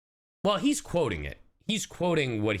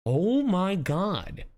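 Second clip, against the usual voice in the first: slow, drawn-out disbelief, higher; stunned; 9 semitones higher, much slower than usual. Real, not acted. Streamer reacting to an absurd game line, slowly stunned. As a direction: let the disbelief stretch out.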